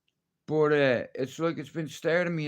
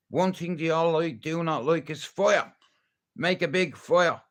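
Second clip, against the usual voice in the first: ordinary talk, nothing marked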